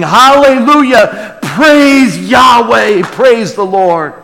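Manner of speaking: high, loud and slow, each praise ringing out; proclaimed praise. Shown as a demonstration. Preacher showing the flock how to cry out praise, loud and slow. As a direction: proclaim it like a shout of worship. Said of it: "we should be saying".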